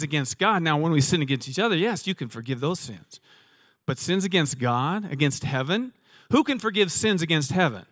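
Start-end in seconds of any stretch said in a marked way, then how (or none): none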